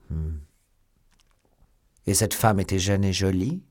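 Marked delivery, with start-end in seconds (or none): none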